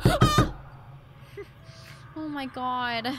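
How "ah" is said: short, loud high scream; startled scream. Real, not acted. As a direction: yelp at a jump scare. Startled by game scare.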